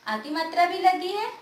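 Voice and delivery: ordinary delivery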